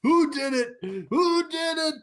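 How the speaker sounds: high, strained mock movie hero demanding; over-the-top action-hero grief; hammed-up vengeful cry. Continued mock Vin Diesel grief.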